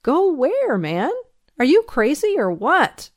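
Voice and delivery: high, incredulous questioning. Acted. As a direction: challenge it in disbelief. Sheriff incredulous at the prisoner's plea.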